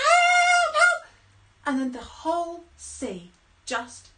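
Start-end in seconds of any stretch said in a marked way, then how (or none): 0.1-1.0 high, loud panicked calls of help; desperate cries for help. Acted. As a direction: shout for help as the boat flips. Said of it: "he was hanging on like this".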